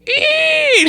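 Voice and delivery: very high, piercing mock squeal; imitated mouse squeal. Storyteller imitates what was heard from the burning pile, high squeal. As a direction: squeal like mice caught in the fire. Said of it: "he just hears, like".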